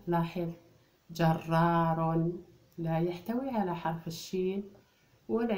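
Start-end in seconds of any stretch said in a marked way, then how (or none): 1.1-2.3 slow, stretched جرار with clear syllables; drawn-out word. Shown as a demonstration. Teacher showing the pupils a word, drawn out. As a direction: pull جرار out slowly for the class. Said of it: "teacher sounds out the word".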